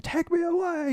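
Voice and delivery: soaring falsetto cry of the line; high falsetto call, echoing; echoey high wail. Retold or imitated. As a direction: sing it out high like a rock finale. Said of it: "it's all echoed".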